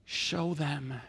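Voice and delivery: soft, low, solemn prayer; praying. Acted. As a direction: pray quietly, pausing between phrases. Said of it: "And then Elijah prays".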